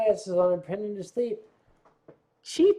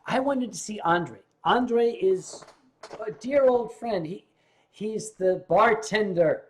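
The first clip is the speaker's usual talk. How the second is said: usual speaking voice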